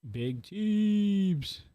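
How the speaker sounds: high drawn announcer call; ring announcer. Acted. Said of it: "Say that again".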